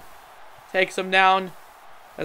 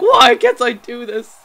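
slow, louder whine of a frustrated wrestler; mock frustrated Crews; 2 semitones higher, 8 dB louder, slower than usual. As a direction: play the champ fuming that nothing works. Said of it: "He's like".

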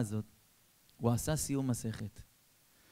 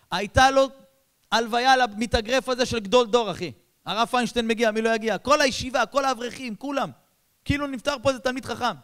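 higher, louder stirring sermon; impassioned preaching; 10 semitones higher, 9 dB louder than usual. Acted. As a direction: preach with awe and fire. Preacher describes grand funeral. (דרשה נרגשת בקול רם)

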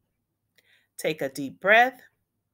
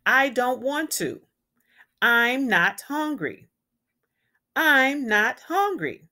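loud, higher, slow cranky whine; 5 semitones higher, 5 dB louder than usual. Acted. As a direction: drag it out like a cranky kid at dinner. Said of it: "He was cranky".

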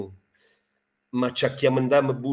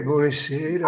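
pompous poet. Acted. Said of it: "fosse già entrato nel personaggio".

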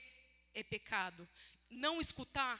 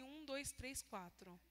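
quiet counting; 9 dB quieter, much slower than usual. Retold or imitated. Counting food in the freezer.

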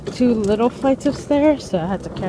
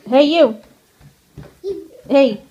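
playful calling out; 5 semitones higher, 6 dB louder than usual. Real, not acted. Mom calls to toddler in pajamas, loud and high. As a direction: call out to get the little one's attention.

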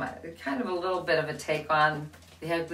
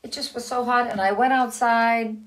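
hen telling her tale; 4 semitones higher, 6 dB louder than usual. Acted. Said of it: "said the hen".

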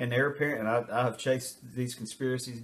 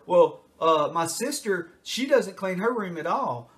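put-on higher, louder childish protest; deflecting kid; 6 semitones higher, 4 dB louder, slower than usual. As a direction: point at someone else. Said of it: "anything to deflect".

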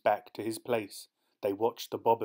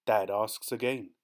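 ordinary delivery, nothing marked

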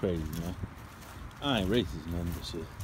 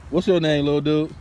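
louder, higher friendly call; 6 semitones higher, 5 dB louder than usual. Real, not acted. Asking the little kid his name, called out loud.

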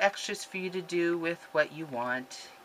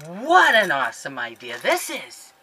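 much louder, bursting with delight; delighted exclaim; 12 dB louder than usual. Real, not acted. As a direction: gush over the gift. Reacting to a gift idea.

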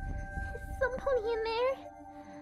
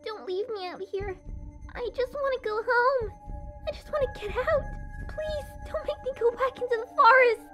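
very high, tearful filly pleading; frightened filly, rising to a desperate plea; desperate begging. Acted. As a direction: beg like a terrified child left outside.

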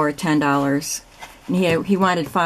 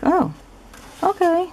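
surprised; 13 semitones higher than usual. Retold or imitated. Retold reaction to vendor's low price.

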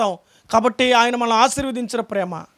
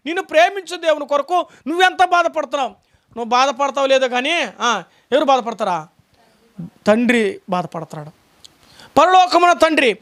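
usual speaking voice

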